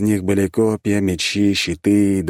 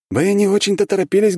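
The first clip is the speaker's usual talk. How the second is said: с усмешкой; 13 semitones higher, 4 dB louder than usual. Acted. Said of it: "хмыкнул он".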